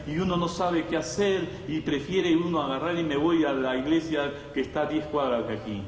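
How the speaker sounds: ordinary delivery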